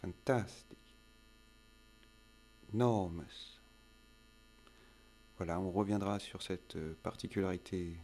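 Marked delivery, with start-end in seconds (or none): 0.0-0.8 "Fantastic." stressed fan-TAS-tic; second-syllable stress. Shown as a demonstration. Teacher showing stress on the second syllable, fan-TAS-tic. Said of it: "la deuxième syllabe". 2.7-3.6 "Enormous." stressed e-NOR-mous; second-syllable stress. Shown as a demonstration. Teacher showing stress on the second syllable, e-NOR-mous. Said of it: "la deuxième syllabe".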